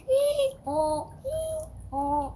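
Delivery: high braying hee-haw; donkey bray. Pretend. Donkey sound asked for.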